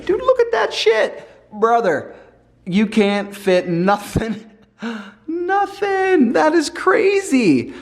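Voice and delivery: high, giggly tipsy exclaiming; tipsy and amazed, laughing. Real, not acted. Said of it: "I'm a little, little tipsy".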